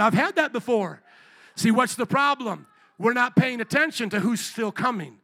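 loud, high preacher's build; preaching at full force. Acted. Preacher pressing the church about Jesus knocking. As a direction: drive it home to the crowd.

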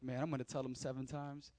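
low, soft inner voice of a proud Peter; Peter's inner thought. Retold or imitated. Peter planning his answer to Jesus, quiet and pleased. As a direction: think it to yourself, pleased. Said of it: "thinking like".

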